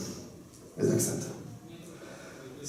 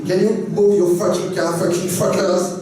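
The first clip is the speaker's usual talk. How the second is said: road rage yell; 6 semitones higher than usual. Retold or imitated. Acted road rage yelling.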